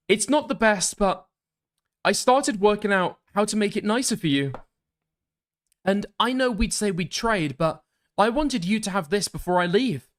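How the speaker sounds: high, gentle texting character; message writer's voice. Acted. Streamer voicing a sender offering a gift, high put-on voice.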